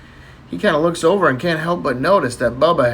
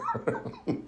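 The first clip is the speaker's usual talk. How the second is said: high laugh; 14 dB quieter than usual. Real, not acted. Laughs at own punchline.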